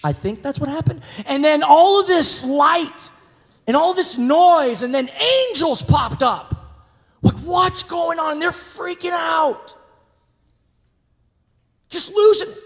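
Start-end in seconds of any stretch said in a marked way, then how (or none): 1.3-12.6 louder, higher, alarmed telling; building excitement. Acted. Pastor telling the congregation how the shepherds freaked out, loud and high. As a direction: paint the panic, rising loud. Angels appear, shepherds panic.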